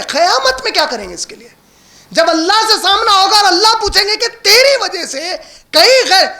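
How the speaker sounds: high-pitched, urgent sermon questioning; impassioned preaching. Acted. Preacher asks listeners how they will face Allah, impassioned.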